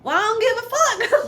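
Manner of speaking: high, loud, careless shout; hammed-up defiant declaration; defiant retort. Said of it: "And I said".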